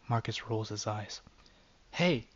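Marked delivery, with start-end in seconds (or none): 1.9-2.3 high, sudden call; a quick, higher-pitched burst; blurted out. A narrator's reading. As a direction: blurt it out to grab attention. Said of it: "Lena blurts out".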